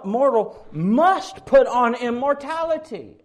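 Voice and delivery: "must" drawn out high and loud; stress on MUST. Acted. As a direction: hit the word "must" with slow, preaching force. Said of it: "notice his emphasis there on must".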